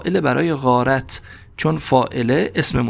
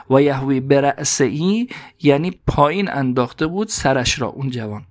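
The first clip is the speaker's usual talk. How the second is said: normal talking voice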